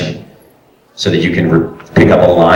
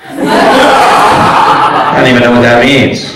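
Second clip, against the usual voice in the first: laughing bewilderment; 2 semitones higher, slower than usual. Real, not acted. Laughing at odd direction.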